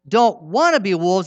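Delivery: ordinary delivery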